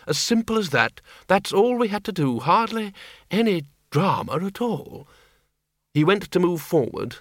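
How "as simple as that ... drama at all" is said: lightly voiced, dry old wizard; a hint of a dry old man; wry old man. Windle's wry remark.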